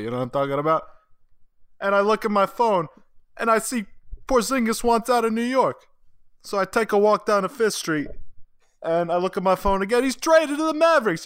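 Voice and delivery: put-on a New Jersey deli guy all worked up, in a thick Jersey accent; Jersey Tony. Friend asks for Jersey Tony's Knicks take, hammed-up New Jersey accent. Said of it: "I wanna hear Jersey Tony in his".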